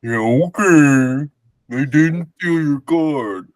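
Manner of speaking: slow, slurred drunk imitation. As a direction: drag the words out like drunk. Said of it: "she was drunk, so it looked like her going".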